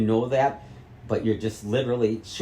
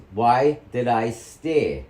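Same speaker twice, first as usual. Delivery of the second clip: slow, pressing repeat of the question; slow demand; 5 dB louder, much slower than usual. Real, not acted. Son pressing his mother, slow and insistent. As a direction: drag each word out to force an answer. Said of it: "Say it".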